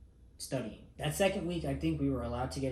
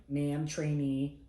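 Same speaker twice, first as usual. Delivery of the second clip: very slow, careful recruit repetition; slow hesitant repeat; 3 semitones higher, 3 dB louder, much slower than usual. Retold or imitated. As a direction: drag it out unsure of the words.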